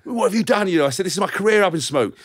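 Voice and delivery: high-pitched indignant protest; retold outrage. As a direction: re-enact panicked fury down the phone. Retold angry phone call.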